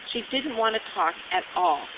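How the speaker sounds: ordinary delivery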